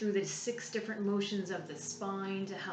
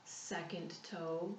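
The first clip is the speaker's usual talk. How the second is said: calm, unhurried meditation voice with long pauses; soothing body-scan guide, softening as it goes; guided relaxation. Real, not acted.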